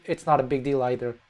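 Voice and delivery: ordinary talk, nothing marked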